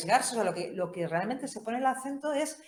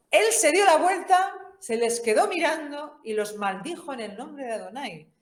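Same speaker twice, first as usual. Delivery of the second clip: lectura dramática; 7 semitones higher, 6 dB louder than usual. Acted. Lectura dramática del relato.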